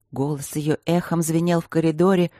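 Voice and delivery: ordinary delivery, nothing marked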